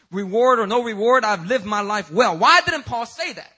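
building from firm to a loud, high preacher's cry; rising preaching. Acted. Preacher challenging the congregation about Paul, building to a loud cry. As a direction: press the question harder each time until it rings out.

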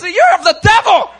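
loud accusing shout at the leaders; rebuking shout. Retold or imitated. Jesus rebuking the Pharisees, loud accusing shout. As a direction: hurl it at the religious leaders.

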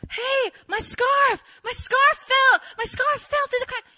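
high, loud, urgent calling; panicked yelling. Retold or imitated. Calling her friend about the scarf, high panicked yelling. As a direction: blurt the disaster at full volume.

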